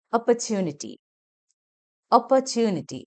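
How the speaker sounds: ഓപ്പർച്യൂണിറ്റി with a short "puh" and stretched "choo"; long YU after T. Shown as a demonstration. Teacher models the word for learners, long middle vowel. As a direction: draw out the യൂ in ഓപ്പർച്യൂണിറ്റി. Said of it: "T യുവിന് ശേഷമാണ് നീട്ടി".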